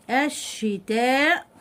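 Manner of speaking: الشتاء stretched very slowly, syllable by syllable; drawn-out spelling word. Shown as a demonstration. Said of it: "يبقى هنكتب كلمة".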